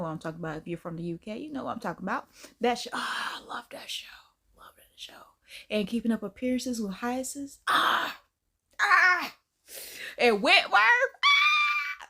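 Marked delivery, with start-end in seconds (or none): 2.6-5.1 fond whisper. Real, not acted. 7.7-12.1 high, loud squeal of joy; excited shriek. Real, not acted. Host squealing over Wentworth, in a high excited shout.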